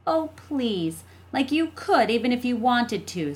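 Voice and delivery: scoffing. A narrator's reading. Jessie dismissing her brother's money plan, scoffing. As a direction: scoff at his big plan.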